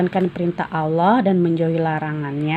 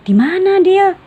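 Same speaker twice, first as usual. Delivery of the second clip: much higher, louder curious ask; eager question; 12 semitones higher, 7 dB louder than usual. Acted. Rehan asks Fadil where, high and eager. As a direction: beg to know, eager. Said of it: "tanya Rehan ingin tahu".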